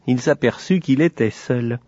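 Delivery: ordinary delivery, nothing marked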